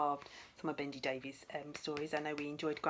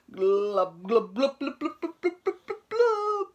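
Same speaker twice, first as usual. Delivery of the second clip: bubbling glubs climbing higher and louder; sinking glub sounds; 10 semitones higher, 14 dB louder, much slower than usual. Acted. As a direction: make the monster gurgle down to the bottom. Monster sinking sound effect.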